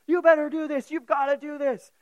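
loud, high angry demands; mad drill sergeant. Retold or imitated. Drill sergeant angrily pressuring the discharged man to obey. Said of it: "He gets all mad".

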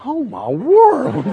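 slow, soft dazzled "Oh my world"; smitten awe. Retold or imitated. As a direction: struck by the girl for the first time. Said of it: "I was like".

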